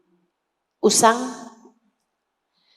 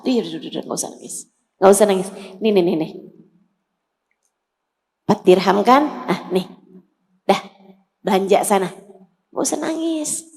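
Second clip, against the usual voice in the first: soft soothing hush, fading to a whisper; 5 semitones lower, 4 dB quieter, much faster than usual. Acted. Prophet hushing the crying girl, soft and soothing.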